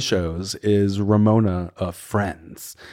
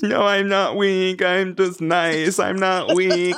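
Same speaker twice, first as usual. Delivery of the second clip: higher, slow mock sob-talk; mock crying Sonia; 7 semitones higher, slower than usual. Retelling drunk Sonia crying to Dorinda, slow and whimpering. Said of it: "Starts to cry".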